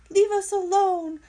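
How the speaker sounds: high, loud terrified squeak; frightened squeak. Acted. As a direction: squeak it out in fear. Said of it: "squeaked Dart".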